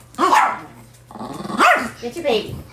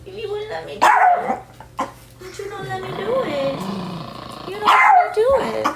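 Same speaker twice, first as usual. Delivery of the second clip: much higher sing-song coaxing; 10 semitones higher than usual. Real, not acted. Owner coaxing the dog during eye trimming, high baby talk.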